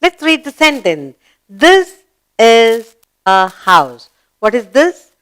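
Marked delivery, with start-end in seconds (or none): 1.5-4.1 loud, slow, separated words; slow model reading. Shown as a demonstration. Teacher reading the house sentence to pupils, slow model reading. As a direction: read it out firmly, word by word.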